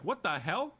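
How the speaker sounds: lightly voiced stunned ruler; baffled Emperor. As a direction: spit it out in mild disbelief. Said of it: "Emperor was like".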